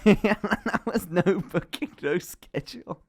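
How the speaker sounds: laughing through it, slowed right down. Real, not acted. Telling viewers about the no-show repairman, laughing. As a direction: crack up while complaining about the repairman.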